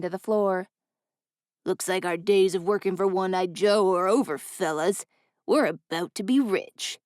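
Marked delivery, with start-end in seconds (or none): 1.6-7.0 gloating gruff man. A narrator's reading.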